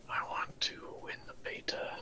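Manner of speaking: greedy hushed whisper. Pretend. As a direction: whisper it like a covetous goblin. Mock greedy whisper about beta.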